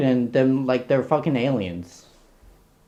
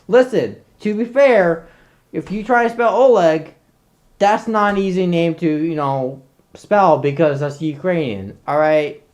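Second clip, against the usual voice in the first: normal talking voice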